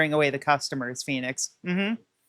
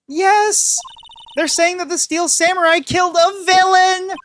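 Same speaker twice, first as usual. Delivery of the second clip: put-on high, loud panicked girl voice; frantic high voice; 11 semitones higher, 5 dB louder than usual. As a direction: blurt it out in a panic.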